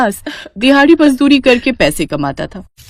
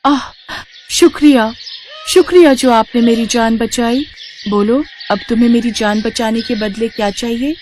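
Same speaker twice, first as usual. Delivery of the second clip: higher, airy fairy voice full of thanks; a freed fairy thanking her rescuer; grateful fairy; 4 semitones higher than usual. Acted. Fairy to the woodcutter who freed her, higher and grateful.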